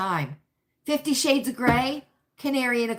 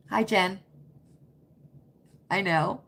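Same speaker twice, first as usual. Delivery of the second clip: ordinary delivery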